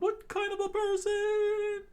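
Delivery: very high, loud amazed shriek; Sanji screaming. A narrator's reading. Sanji amazed at Luffy's punch, high loud shriek. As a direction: shriek it in cartoon disbelief. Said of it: "Sanji screamed out".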